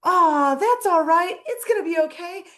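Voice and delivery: cheerful Joy voice. Retold or imitated. Joy comforting Bing Bong at the cliff, high chirpy cheer. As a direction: play Joy cheering up a sad friend. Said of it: "And so she's like".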